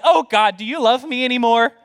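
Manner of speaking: hammed-up whine of self-doubt to God; mock distress. Believer overreacts to a slight setback, mock distressed. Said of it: "I'm like".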